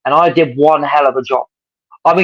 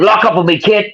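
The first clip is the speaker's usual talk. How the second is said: attempted American accent; 7 semitones higher than usual. Ordering the kid to lock up, American accent. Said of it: "I can't do an American accent, but I'll try".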